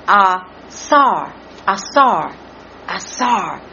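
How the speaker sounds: "asar" with a long, stressed "sar"; "asar" repeated slowly, second syllable emphasised. Shown as a demonstration. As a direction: sound out "asar" carefully, weight on the end. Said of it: "emphasis on the S A R".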